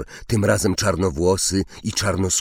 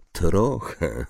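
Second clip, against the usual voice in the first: melancholy echo. A narrator's reading. Centaur echoes her answer.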